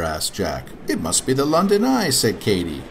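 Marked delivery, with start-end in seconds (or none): 0.9-2.1 put-on higher, fast child voice; little girl voice. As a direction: blurt it like a girl. Katy voiced.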